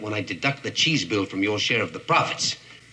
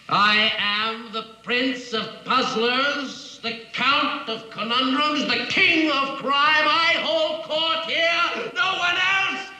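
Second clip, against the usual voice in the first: rising higher and higher, slow proclamation; grand boast; 13 semitones higher, slower than usual. Acted. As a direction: build from pompous to manic. Riddler proclaims himself king.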